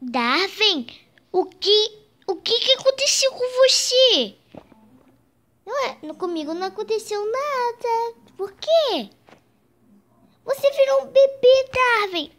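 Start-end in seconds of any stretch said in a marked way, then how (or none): 1.3-4.3 shocked question. Acted. Shocked at the transformation. 10.4-12.3 excited exclaim. Acted. Tells Darwin he became a baby.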